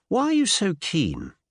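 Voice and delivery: plain reading voice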